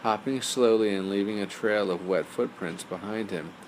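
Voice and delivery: ordinary delivery, nothing marked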